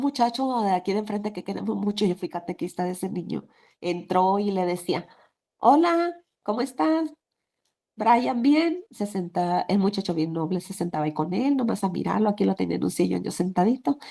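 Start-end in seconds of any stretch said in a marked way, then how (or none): 5.6-8.8 cheerful greeting. Retold or imitated. Husband greets young neighbor.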